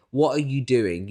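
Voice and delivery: ordinary talk, nothing marked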